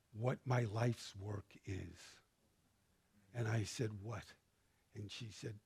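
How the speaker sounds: low, very soft, moved retelling; hushed and real, with heavy pauses; close to tears. Real, not acted. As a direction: tell it quietly, holding back tears. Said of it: "you're gonna make me cry".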